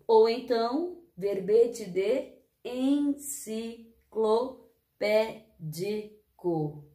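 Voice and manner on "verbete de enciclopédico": very slow, syllable by syllable; sounding out words. Shown as a demonstration. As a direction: stretch every syllable. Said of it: "Falar sílaba por sílaba?".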